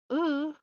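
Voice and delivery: high, loud grunt of disgust; sharp groan of exasperation; disgusted ugh. Real, not acted. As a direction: burst out with an irritated ugh. Disgust at the smirking character.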